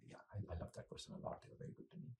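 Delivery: whispered quickly, half to yourself; voiceless hush of a grieving mind; whispered inner thought. Retold or imitated. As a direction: murmur the thought under your breath, fast. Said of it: "you think, you know".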